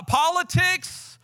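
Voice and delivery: high, loud, drawn-out questioning call; mock distraction. Preacher acting out distractions from Jesus, high drawn-out mock query. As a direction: stretch it upward like a tempting interruption.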